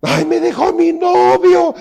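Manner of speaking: very high, slow, loud put-on whimper; mock heartbroken wail. Mocking a woman crying over her boyfriend, in a high wail. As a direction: ham up a dumped girlfriend whining.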